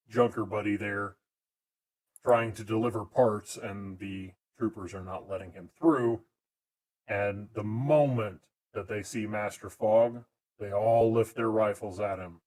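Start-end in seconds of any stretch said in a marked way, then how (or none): none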